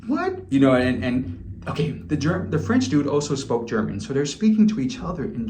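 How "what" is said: incredulous what. Retold or imitated. German guy hears second thoughts, asks a very high stunned what. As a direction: shoot the word up high in disbelief. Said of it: "And he's like".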